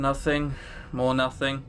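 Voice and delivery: usual speaking voice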